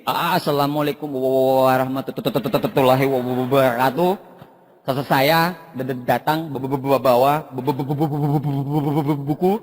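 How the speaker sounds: low, laboured mimicked stammer; exaggerated stutter at a formal greeting; stuttering speech. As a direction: get badly stuck on every first syllable. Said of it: "Gagap berdiri".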